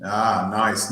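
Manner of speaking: ordinary delivery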